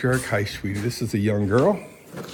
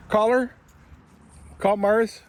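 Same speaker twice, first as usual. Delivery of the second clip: calling the dog; 10 semitones higher, slower than usual. Real, not acted. Calling the dog Mars outside.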